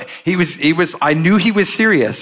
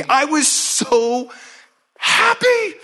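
much higher, slow, stretched-out joy; overjoyed; 12 semitones higher, much slower than usual. Real, not acted. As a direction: sing out the word happy. Recalls being healed.

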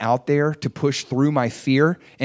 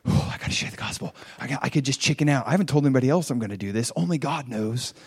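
anxious inner thoughts; 4 dB quieter, faster than usual. Retold or imitated. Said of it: "inner anxious thoughts at gas station".

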